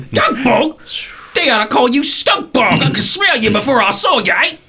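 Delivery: put-on cranky old crone yelling through the dust; tottery old lady. Shack's old lady shouting at the stranger at her door, high and cranky. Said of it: "this tottery old lady".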